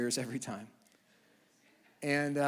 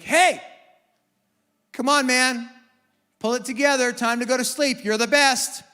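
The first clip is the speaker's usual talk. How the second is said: much higher, much louder call; calling from the door; 9 semitones higher, 12 dB louder than usual. Retold or imitated. Dad at the door telling son to sleep, calling loudly. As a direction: call across the room to settle him.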